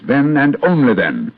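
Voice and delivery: detective swearing to bring Moriarty to justice; solemn emphasis. Acted. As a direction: make it a vow. Vow about Moriarty.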